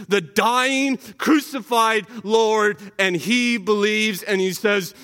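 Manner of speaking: ordinary talk, nothing marked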